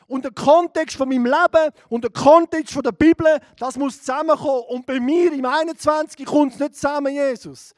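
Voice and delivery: preacher reliving his loud prayer to Jesus; loud pleading prayer. Retold or imitated. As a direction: pray it out loud, desperate. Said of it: "Ich habe im, im Mai angefangen".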